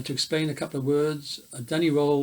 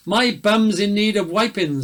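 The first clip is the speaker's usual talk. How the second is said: desperate cry; 5 semitones higher, 5 dB louder than usual. A narrator's reading. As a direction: mock-desperate cry, raised and louder. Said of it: "someone cried in desperation".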